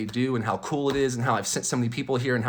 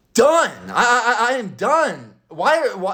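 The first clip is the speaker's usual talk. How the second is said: a sudden high, loud DONE; exasperated outburst; 8 semitones higher, 4 dB louder, slower than usual. Real, not acted. Vlogger venting about mask rules, a loud fed-up outburst. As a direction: blurt it out, completely fed up.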